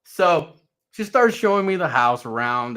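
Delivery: normal talking voice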